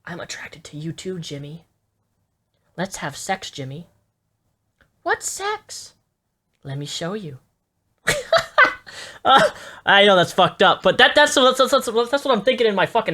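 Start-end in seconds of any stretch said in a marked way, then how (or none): 0.1-3.9 creepy hushed voice. Pretend. Acting predator reply to child. 5.0-5.9 put-on high innocent child voice; baby voice. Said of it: "In a little baby voice". 6.6-7.4 creepy hushed voice. Pretend. Acting predator in skit. 8.1-9.2 high burst of laughter. Real, not acted. Laughs at own skit.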